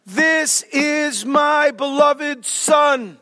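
very slow, drawn-out proclamation; a majestic heavenly voice, slow and solemn; voice of God. Retold or imitated. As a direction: boom it down from the cloud, each word stretched. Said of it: "a voice out of the cloud says".

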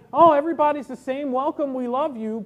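high, loud, hurried show of welcome; mock welcome. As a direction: parrot an effusive greeting that may be an act. Mimics society's put-on welcome.

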